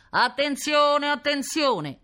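high, loud, drawn-out call; booming loudspeaker announcer. A narrator's reading. Police loudspeaker calls to the whole neighbourhood, booming announcement. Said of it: "ricominciò".

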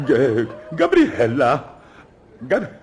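high, loud, drawn-out bellow of her name; outraged yell. Acted. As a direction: shriek it, stretching out the name. Husband finds bill, yells for wife.

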